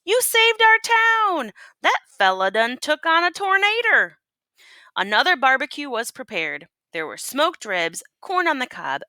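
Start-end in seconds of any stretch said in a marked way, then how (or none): 0.0-4.2 cheering. A narrator's reading. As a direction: cheer like a grateful crowd. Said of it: "Folks cheered".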